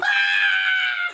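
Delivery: high shrieking yelp; pained shriek. Acted. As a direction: shriek as the spray hits. Sprayed with Clorox.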